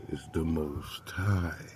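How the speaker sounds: ordinary delivery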